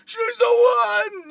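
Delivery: high, drawn-out cry of warning; urgent shout. Acted. Imagined Flash calling out to Bruce, urgent high shout. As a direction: yell it across a great distance, stretching each word.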